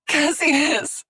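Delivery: high anguished cry. A narrator's reading. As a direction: call out his name in agony. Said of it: "she cried".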